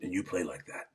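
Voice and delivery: lightly voiced older man, soft; older coach. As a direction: imitate a coach's quiet praise. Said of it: "it was an older man".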